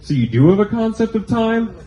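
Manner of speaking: high mock-innocent "oh", sarcastic question; sarcastic high-pitched jab; sarcastic retort. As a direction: feign surprise to needle the girlfriend. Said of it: "And I was just like".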